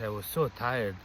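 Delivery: ordinary delivery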